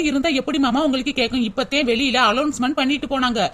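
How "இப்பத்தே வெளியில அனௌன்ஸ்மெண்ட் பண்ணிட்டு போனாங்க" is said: ordinary talk, nothing marked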